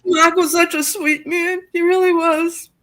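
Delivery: high, slow, grieving voice; emotional. Real, not acted. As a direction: mourn a dear friend, voice lifted and drawn out. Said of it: "I'm sorry if I get emotional".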